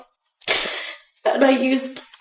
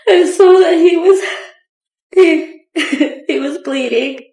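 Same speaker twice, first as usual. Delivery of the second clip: barely holding it together; shaky nervous witness; 3 semitones higher, 3 dB louder than usual. Real, not acted. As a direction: voice wavering with nervous laughter. Witness recalls finding bleeding husband.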